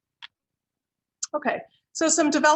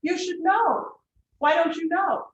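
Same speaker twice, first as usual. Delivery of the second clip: imitating an indignant client; 6 semitones higher, 3 dB louder, slower than usual. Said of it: "So when they say".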